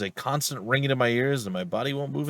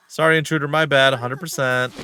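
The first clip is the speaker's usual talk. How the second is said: loud, higher-pitched hasty apology; apologetic rowdy detective; 5 semitones higher, 6 dB louder than usual. Acted. Rowdy detective apologising to the choked intruder, loud and contrite.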